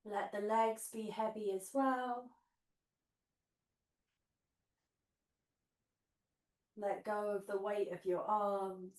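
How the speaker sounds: guided meditation. Acted. Leads guided relaxation on mat.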